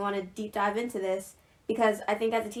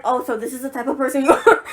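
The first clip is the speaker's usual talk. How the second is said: laughing; 9 dB louder than usual. Retold or imitated. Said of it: "I was like".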